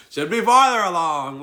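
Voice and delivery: hammed-up complaint at yourself; mock exasperation. Continues the mock self-complaint.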